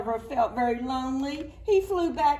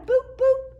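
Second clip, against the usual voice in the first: excited high boop noises, louder; but louder and higher; 9 dB louder than usual. Pretend. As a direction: pop each boop up high and loud. Pressing the firefly again.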